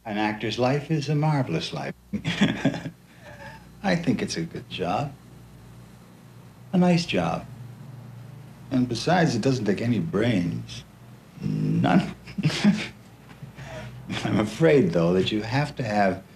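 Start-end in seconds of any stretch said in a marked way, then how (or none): 2.1-2.9 quiet, breathy little laugh; soft chuckle. Real, not acted. Chuckles at own remark. 12.2-12.9 brief breathy laugh; a little chuckle at your own joke; short chuckle. Real, not acted. Laughs after his joke.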